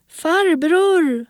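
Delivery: one long, high call; girl calling out. A narrator's reading. Girl calls for the hidden man in darkness, long high call. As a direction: stretch the word into a high cry for him. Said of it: "ropade hon".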